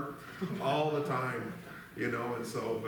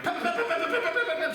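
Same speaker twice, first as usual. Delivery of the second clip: rapid high "pep pep" gunshot noises, laughing; imitated rifle shots; 5 dB louder than usual. Kids plinking at geese, mock rifle pops. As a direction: spit out high popping shots like a twenty-two. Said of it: "shooting them with the twenty-two".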